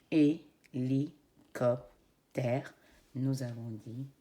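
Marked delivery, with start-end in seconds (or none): 0.1-2.7 hélicoptère drawn out beat by beat; syllables stretched out. Shown as a demonstration. Teacher showing children the syllables, slowly. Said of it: "compter le nombre de syllabes".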